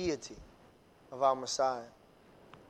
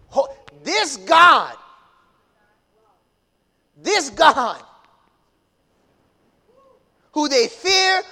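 loud, raised pulpit cry; full-force preaching; 8 semitones higher, 9 dB louder than usual. Real, not acted. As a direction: hit key words hard, with dramatic pauses.

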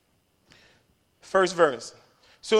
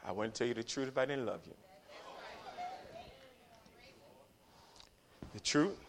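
very soft, low and sincere; tender reassurance; 3 semitones lower, 17 dB quieter than usual. Real, not acted. Pastor softens after being hard on them, tender reassurance. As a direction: drop right down and reassure them gently.

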